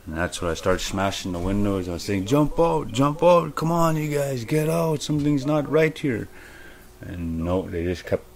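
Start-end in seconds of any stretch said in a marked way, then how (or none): 2.2-6.3 high, frantic cries to jump out; desperate plea. Retold or imitated. Dreamer smashes windows and begs partygoers to jump out, high and frantic. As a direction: beg them to escape now.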